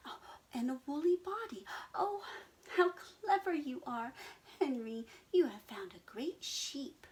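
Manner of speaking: high, fast gushing praise; delighted sheep. Acted. Daisy praising Henry, high and gushing. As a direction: gush over how clever he is.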